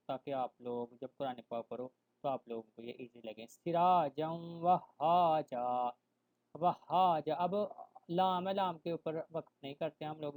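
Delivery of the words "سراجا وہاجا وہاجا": drawn-out recited سراجا وہاجا with vowels held long; slow Quran recitation. Shown as a demonstration. Teacher chanting سراجا وہاجا slowly for the students. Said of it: "teacher recites Quran example".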